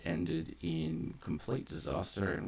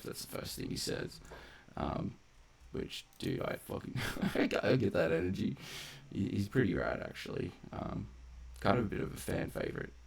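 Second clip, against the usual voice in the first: ordinary delivery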